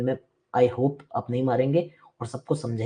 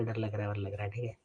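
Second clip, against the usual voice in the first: soft whiny mock of a frightened pupil; a hushed, exaggerated scaredy-cat imitation; mocking scared student; 6 semitones lower, 15 dB quieter than usual. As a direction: mimic the student's fake fear, quietly. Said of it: "ये नाटक मत किया कर".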